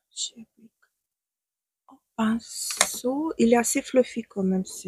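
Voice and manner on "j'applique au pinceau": whisper. Real, not acted. Chuchote en appliquant le fond de teint.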